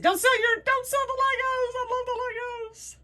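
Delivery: high, loud hammed-up begging; mock pleading. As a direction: wail it, imitating your own desperate plea. Retells own plea to keep Legos.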